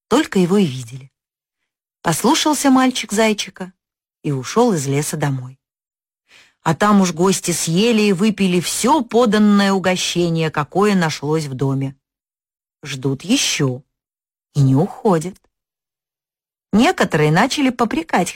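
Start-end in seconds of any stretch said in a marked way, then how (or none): none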